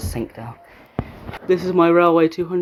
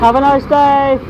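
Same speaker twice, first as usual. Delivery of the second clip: calling out; 8 semitones higher, 7 dB louder than usual. Real, not acted. Calling out to train staff.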